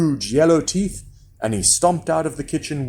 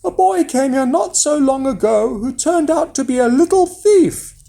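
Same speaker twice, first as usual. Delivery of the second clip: high, rising indignation; giant's wife accusing, much higher; suspicious wife; 12 semitones higher, 5 dB louder than usual. A narrator's reading. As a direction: complain in a high woman's voice, louder at the end. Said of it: "she said".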